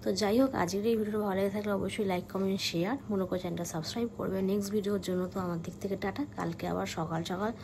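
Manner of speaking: quiet late-night vlog voice; speaking softly. Real, not acted. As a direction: keep it down, it's past one at night. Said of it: "আস্তে আস্তে কথা বলছি".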